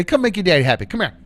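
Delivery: loud, bright call to a dog; excited calling the dog. Real, not acted. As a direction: call the puppy over, eager.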